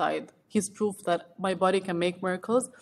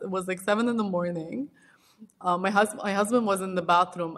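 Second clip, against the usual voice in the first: normal talking voice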